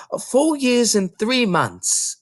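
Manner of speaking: put-on high, slow child's voice; little girl voice. Little girl telling the librarian her age, high and slow. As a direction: state your age carefully like a tiny girl.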